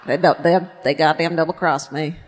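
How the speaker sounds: low attempt at a film star's accent; put-on accent, lightly playing the double-crossed woman; Holly Hunter. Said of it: "I'm just doing Holly Hunter".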